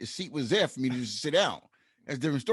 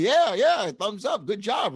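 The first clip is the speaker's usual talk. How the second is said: cheerful neighbor; 7 semitones higher, 5 dB louder, slower than usual. Retold or imitated. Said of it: "he said".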